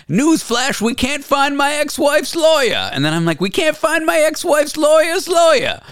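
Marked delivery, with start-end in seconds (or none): 0.1-2.9 high, slow mock Italian announcement; Tony Pepperoni. Retelling his radio bit, mock Italian character voice. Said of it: "I made a character named Tony Pepperoni". 3.4-5.8 high, shouted mock Italian rant; Tony Pepperoni shouting. Retelling his radio bit, shouting mock Italian character. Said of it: "I made a character named Tony Pepperoni".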